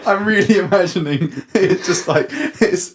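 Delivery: laughing through words. Real, not acted.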